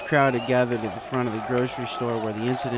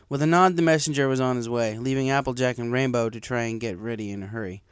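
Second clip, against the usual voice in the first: usual speaking voice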